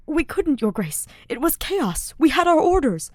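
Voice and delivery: high, loud, pleading; panicked excuse. A narrator's reading. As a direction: stammer it defensively. Frightened soldier defends himself.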